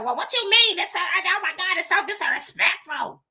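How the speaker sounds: high, affected outraged voice slowing at the end; mock indignant lady. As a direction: imitate her clutching her pearls. Mimicking the indignant woman.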